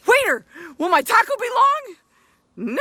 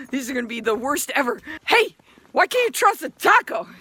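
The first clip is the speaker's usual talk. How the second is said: usual speaking voice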